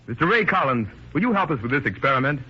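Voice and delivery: usual speaking voice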